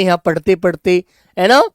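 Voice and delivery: ordinary talk, nothing marked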